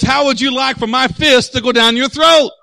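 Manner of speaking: loud, high, menacing challenge; retold belligerent outburst; imitated threat. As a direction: threaten him like an irate sports parent. Acts the man's threat.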